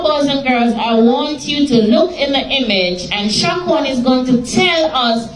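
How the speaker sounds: usual speaking voice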